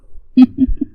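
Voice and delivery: a short, high mocking laugh. Groom mocking his shocked bride, short high laugh. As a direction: laugh at her with a touch of mockery. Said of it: "وہ ہنس رہا تھا".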